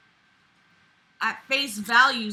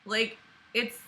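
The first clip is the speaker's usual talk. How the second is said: normal talking voice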